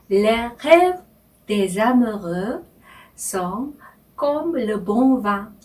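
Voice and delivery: each word set apart, Les rêves des amoureux sont comme le bon vin; slow French reading. Shown as a demonstration. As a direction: read the French line slowly, word by word, with pauses. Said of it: "천천히 제가 읽으면서".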